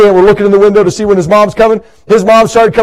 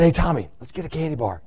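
conspiratorial hush; 7 semitones lower, 16 dB quieter than usual. Retold or imitated. Kid tempting friend.

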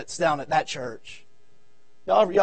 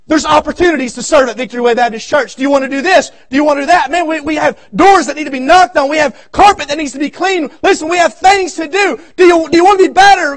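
loud, high preaching build, shouting the questions; preaching at full force; 8 semitones higher, 12 dB louder than usual. Acted. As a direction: press the congregation like a revival preacher. Pastor preaching to congregation.

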